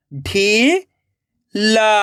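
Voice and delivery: ढीला split into ढी, long pause, ला; rising ढी, then ला alone; syllable by syllable. Shown as a demonstration. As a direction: sound out ढीला very slowly in two parts. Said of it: "एक एक अक्षर का उच्चारण करना है".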